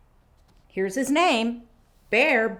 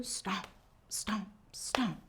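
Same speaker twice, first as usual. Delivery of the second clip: whisper; 7 dB quieter, slower than usual. Shown as a demonstration. Whispered stomping chant.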